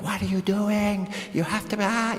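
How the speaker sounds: high-pitched mock yell at the kid; panicked parent yelling. Parent panicking at a second grader over spelling, high mock yell. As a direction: squeal it out like a frantic parent. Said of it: "and you're like".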